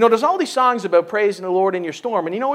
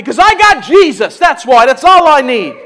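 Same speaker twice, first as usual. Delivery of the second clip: joyful shout; 2 semitones higher, 9 dB louder than usual. Acted. Preacher to church, shouting his joy in Jesus. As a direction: shout it out like a happy believer.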